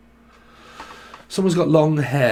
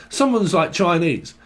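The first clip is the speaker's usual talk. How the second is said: usual speaking voice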